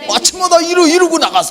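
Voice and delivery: high, loud hammed-up rant; a husband ranting at his wife each morning, hammed-up and loud; mock rant. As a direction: overplay an angry husband storming off.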